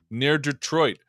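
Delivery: normal talking voice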